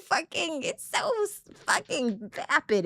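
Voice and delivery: very high, drawn-out Southern airhead voice; high, slow mock Southern accent; mock Southern sweetness. Shown as a demonstration. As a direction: squeal it like a vapid Southern belle. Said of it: "put it in a Southern accent".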